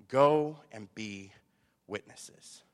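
slow, loud GO, then dropping on witnesses; solemn command. Acted. Preacher quotes Christ's final statement, solemn command. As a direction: space out "Go and be witnesses" with pauses.

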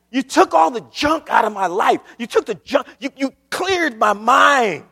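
loud, high testimony from the pulpit; fervent preaching. Acted. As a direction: cry it out to the congregation. Preaching testimony at full force.

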